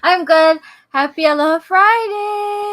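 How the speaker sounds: loud, high celebratory holler; exuberant greeting. Real, not acted. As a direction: belt out the greeting to the crowd. Co-host shouts her weekly greeting.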